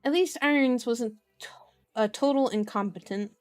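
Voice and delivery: usual speaking voice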